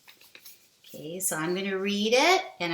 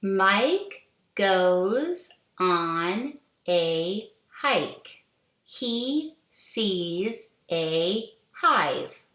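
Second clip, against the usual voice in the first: slow read-along; slower than usual. Shown as a demonstration. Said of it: "Let's read it again".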